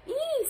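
very high pig squeal; short, high squeal of alarm. A narrator's reading. Pig looking up at the sky, squealing very high. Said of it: "squealed Pig".

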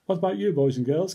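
plain reading voice

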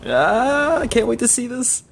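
high, eager voice; giddy squeal. Real, not acted. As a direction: squeal with anticipation. Excited before first fight.

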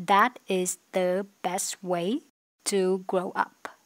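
slow, careful English read; slow model reading. Shown as a demonstration. Teacher rereads the sentence slowly to students. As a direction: stretch each word for learners. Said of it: "teacher rereads model sentence".

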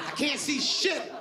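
usual speaking voice

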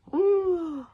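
loud, high gasp; theatrical sharp intake of breath; shocked gasp. Acted. Characters see they are in danger, shocked gasp.

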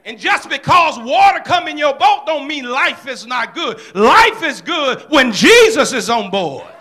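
loud, ringing church preaching; booming Sunday sermon voice; full-force preaching. Acted. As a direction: preach it out to the whole congregation.

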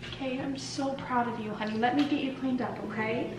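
soft, slow hushed comfort; soft reassurance. Real, not acted.

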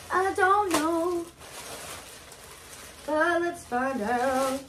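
mock mystery voice. Mock spooky unboxing voice.

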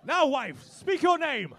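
loud, hammed-up order to the wife; mock command. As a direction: boom it like a lord of the house.

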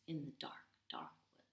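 whispered, spooky. A narrator's reading. Narrator whispering about the dark woods. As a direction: hush it like a scary secret.

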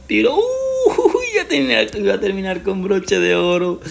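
high laughing exclamation; excited near miss. Real, not acted. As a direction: yelp then laugh it off.

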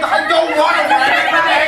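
loud, high refusal; shouting. Acted. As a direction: yell the refusal. Refusing rabbit dinner.

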